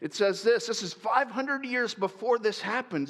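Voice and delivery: ordinary talk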